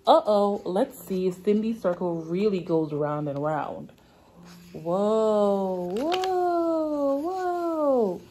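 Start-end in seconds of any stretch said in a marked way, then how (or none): 4.7-8.2 amazed whoa. Acted. Reader marvels at spinning circle.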